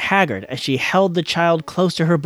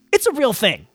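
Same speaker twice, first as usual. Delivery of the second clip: snapped defensively; 6 semitones higher than usual. A narrator's reading. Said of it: "she snapped defensively".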